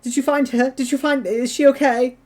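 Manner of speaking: high, choked, desperate pleading; worried dragon keeper sobbing down the phone to the party; sobbing Seska. Acted. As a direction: beg through tears for news. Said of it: "he's, like, sobbing on the other".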